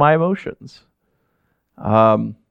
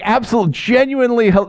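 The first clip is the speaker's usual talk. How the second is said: normal talking voice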